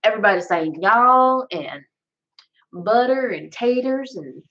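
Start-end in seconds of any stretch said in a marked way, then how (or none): 0.8-1.5 high Southern "y'all"; exaggerated American South drawl; Southern American accent. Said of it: "start sounding weird for some reason". 2.7-3.3 "butter" in a higher Southern voice; mock American South accent. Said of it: "start sounding weird for some reason". 3.5-4.2 high mock-Southern "taters"; put-on American South accent. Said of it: "start sounding weird for some reason".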